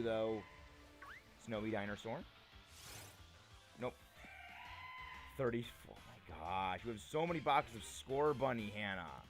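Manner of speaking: ordinary delivery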